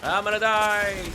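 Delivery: loud, slow, drawn-out cry of panic; panicked yell. Real, not acted. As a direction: shout it as if about to die in the fight. Panics in a game fight.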